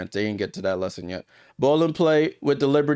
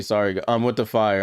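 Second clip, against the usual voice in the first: ordinary talk, nothing marked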